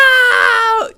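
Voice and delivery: long, high agonized scream; loud drawn-out movie-hero wail; Luke Skywalker. Acted. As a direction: scream it out in hammed-up despair. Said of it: "when he screams".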